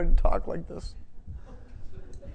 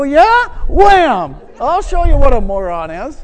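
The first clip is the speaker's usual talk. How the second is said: louder, higher mock comeback with a "Wham!"; mock threat; 6 semitones higher, 10 dB louder than usual. Insulted man snapping back at the name-caller, mock angry.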